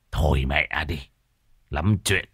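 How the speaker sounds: low, unimpressed brush-off; curt dismissal. Acted. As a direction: wave the advice away in a low voice. Husband waves off friend's advice.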